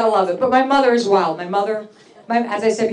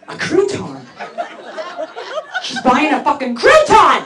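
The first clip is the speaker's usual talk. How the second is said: disbelief; 5 semitones higher than usual. Retold or imitated. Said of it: "listening to this message going".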